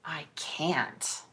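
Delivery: a soft, breathy whispered refusal; embarrassed whisper. Real, not acted. Asked to do a Sean Connery accent, a shy whisper.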